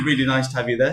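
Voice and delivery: usual speaking voice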